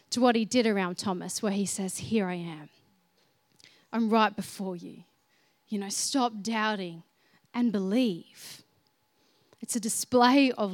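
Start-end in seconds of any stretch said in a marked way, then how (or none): 2.0-8.6 tender Jesus voice. Acted. Jesus softly reassuring a doubting Thomas. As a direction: speak it gently, like a hand on the shoulder.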